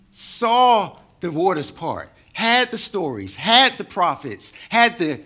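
a preacher rising to a loud climax; preaching at full force. Acted. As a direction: pound each phrase home to the congregation. Preaching climax over Israelites.

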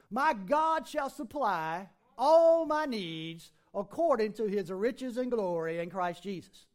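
slow, loud preaching of scripture. Acted. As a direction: proclaim the verse with full force. Preaching scripture at full force.

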